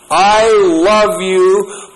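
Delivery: drawn out like a heartfelt vow; tender declaration. Acted. Peter declaring love to Jesus, slow earnest vow.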